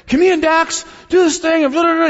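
put-on high, loud five-year-old voice, bursting with excitement; excited little kid. Dad imitating foster son asking permission, excitedly. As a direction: imitate an eager child blurting out a request. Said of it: "he's all excited".

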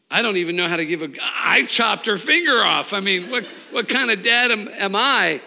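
high, loud cry of guilt; anguished self-reproach. Retold or imitated. Father blaming himself on the drive to hospital, anguished loud cry. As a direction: shout it at yourself in despair.

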